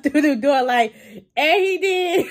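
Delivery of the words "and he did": high, laughing punchline; cracking up. Retold or imitated. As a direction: barely get it out for laughing. Retelling Danny's jab, cracking up.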